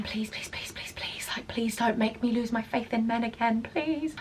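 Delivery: hushed, rapid string of pleas; anxious inner pleading. Retold or imitated. Doorwoman silently begging to keep her faith in men, hushed pleading. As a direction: beg silently under your breath. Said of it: "bubbling a bit, thinking".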